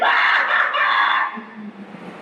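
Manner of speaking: preacher cracking up at his own Disney story; big laugh. Real, not acted. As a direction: laugh out at the joke.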